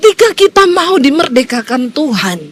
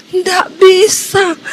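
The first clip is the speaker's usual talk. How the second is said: slow, choked words forced out; as if barely able to breathe; a dying man straining to answer; strained struggling refusal; 6 semitones higher, much slower than usual. Retold or imitated. Choking husband cannot say Jesus.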